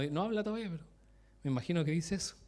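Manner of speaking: ordinary talk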